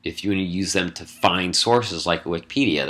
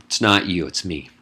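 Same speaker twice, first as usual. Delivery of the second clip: usual speaking voice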